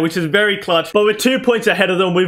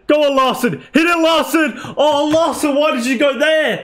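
urging cheer; 7 semitones higher than usual. Real, not acted. Gamer urging his winger to shoot, rising excited calls. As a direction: egg him on to hit it.

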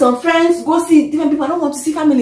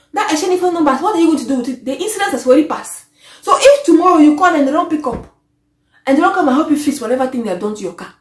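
ordinary delivery

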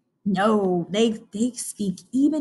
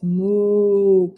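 long, deep cow moo; really deep moo; 2 semitones lower, 3 dB louder than usual. Shown as a demonstration. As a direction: moo low like a farm cow. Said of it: "it's really deep".

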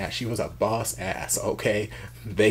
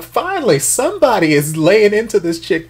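much higher and louder, triumphant; gleeful cheer; 11 semitones higher, 11 dB louder than usual. Real, not acted. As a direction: celebrate like your team finally scored. Cheering the beating.

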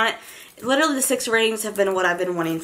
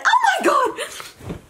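a drawn-out, much higher, slow squeal of joy; excited squeal; much slower than usual. Real, not acted. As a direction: stretch out Oh my God in real delight. Thrilled at the purchase.

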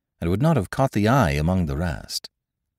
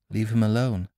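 ordinary delivery, nothing marked

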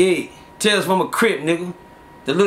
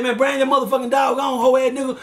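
dare shout; 6 semitones higher than usual. Retold or imitated. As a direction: call him out like you mean a fight. Rival daring him, shouted.

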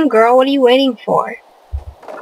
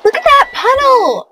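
pitched way up, disgusted; disgusted cry at the puddle; disgusted surprise; 12 semitones higher, 3 dB louder than usual. Real, not acted. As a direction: recoil at the mess. Reacting to mess in game.

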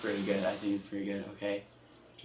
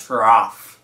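backwards speech; 12 dB louder than usual. Shown as a demonstration. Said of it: "we're gonna say words backwards".

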